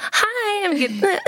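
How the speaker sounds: high-pitched, mock-flustered hi that falls apart; awkward nervous greeting. Actress re-enacting her uncomfortable audition entrance, awkward and high. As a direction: squeak a hello, then malfunction. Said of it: "I get very awkward".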